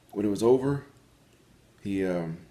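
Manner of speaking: talking with food in mouth; words around a mouthful; mouth full. Real, not acted. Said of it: "sorry, I had something in my mouth".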